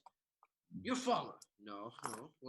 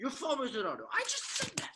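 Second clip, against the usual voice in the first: louder, much higher exasperated cry; exasperated outburst; 9 semitones higher, 5 dB louder than usual. Acted. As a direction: blow up at your own mistake. Flubbing again, exasperated.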